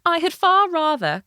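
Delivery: high, loud cried princess line; cartoon princess. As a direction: cry it out like a storybook princess. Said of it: "cried the princess".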